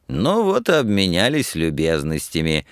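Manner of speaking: ordinary delivery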